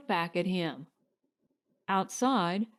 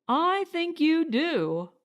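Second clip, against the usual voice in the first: retort; 8 semitones higher, slower than usual. A narrator's reading. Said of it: "Claude retorted".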